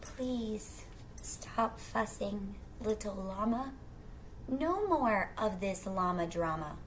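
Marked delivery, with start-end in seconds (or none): none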